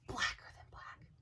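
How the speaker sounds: breathy, voiceless spooky whisper. Acted. Repeating the shadow figure's darkness for effect, whispered. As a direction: whisper it like a ghost story.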